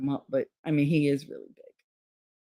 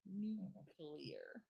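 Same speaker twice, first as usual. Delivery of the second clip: normal talking voice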